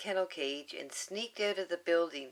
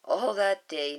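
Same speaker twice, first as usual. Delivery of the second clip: ordinary delivery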